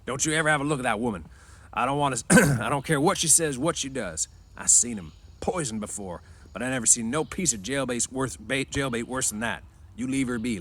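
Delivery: a hint of a fierce older friend laying down the law; mild fierce scolding; fierce warning. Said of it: "he said fiercely".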